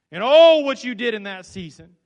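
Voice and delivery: loud, rising cry of wonder; preacher's joyful shout; exclaimed oh. Real, not acted. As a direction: burst out with awe.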